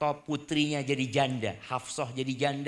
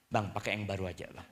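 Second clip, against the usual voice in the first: softer, lower coaxing; soothing wife; 7 semitones lower, 6 dB quieter than usual. Acted. Wife soothing her angry husband, lightly voiced and soft. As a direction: coax him gently. Wife offering new socks.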